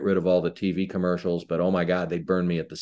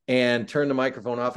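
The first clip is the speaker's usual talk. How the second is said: ordinary talk, nothing marked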